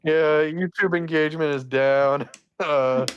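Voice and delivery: sad clown voice, lightly done; a hint of a depressed clown; Chuckles voice. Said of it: "play Chuckles for me again".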